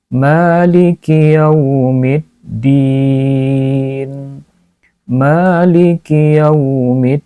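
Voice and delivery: "Maliki yaumiddin" chanted with rising ends; recited demo. Shown as a demonstration. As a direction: intone it as a model reading.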